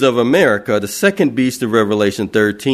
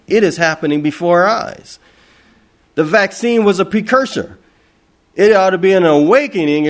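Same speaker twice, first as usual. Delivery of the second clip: ordinary talk, nothing marked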